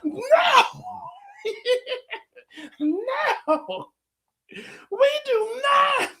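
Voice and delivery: high, slow, laughing scolding; mock stern parent. Dad scolding daughter in the bookstore, high and laughing. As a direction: play the strict dad but crack up. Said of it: "trying to be a responsible parent".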